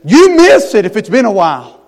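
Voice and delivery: ordinary talk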